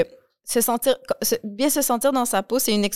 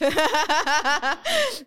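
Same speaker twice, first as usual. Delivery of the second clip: high giggling burst; laugh; 8 semitones higher than usual. Real, not acted. Rit après la démonstration. (éclat de rire aigu)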